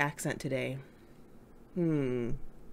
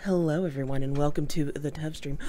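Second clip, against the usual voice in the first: usual speaking voice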